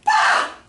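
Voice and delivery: sudden loud acted yell; mock outburst yell. Teacher losing it at a child, mock yell. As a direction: burst out as if patience snapped. What you can't do to kids.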